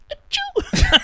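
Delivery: a tiny cartoon mouse sneezing; tiny mouse sneeze. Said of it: "goes past and goes".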